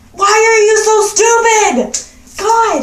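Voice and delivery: venting about a dumb ex, high and loud; exasperated. Retold or imitated. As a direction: throw the question at him in disbelief.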